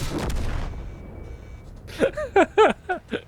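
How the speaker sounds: squealing burst of laughter; high shrieking laugh. Real, not acted. Laughing at chaos.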